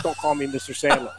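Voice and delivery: mock-protest through a laugh; a host telling off his guest through a laugh. Sandler quoted, laughing at breakfast.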